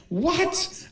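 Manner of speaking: high, rising disbelief; a stunned question shooting up in pitch; amazed double take; incredulous. Acted. Reacts to pants coming off.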